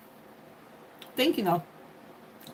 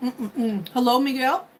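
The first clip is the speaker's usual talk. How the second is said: normal talking voice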